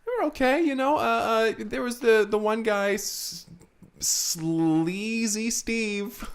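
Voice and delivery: put-on squeaky high old janitor. Janitor complains about the critic in a high squeaky voice.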